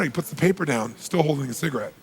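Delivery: normal talking voice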